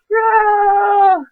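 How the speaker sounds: high, loud drawn-out hurrah; mock celebration, cheer it out; big playful whoop. Cheers end of spoiler section.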